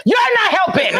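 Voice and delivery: high angry shout; snapping. Retold or imitated. Wife snapping at her calm husband, high angry shout. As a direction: snap at him, pitch way up. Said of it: "I was so mad".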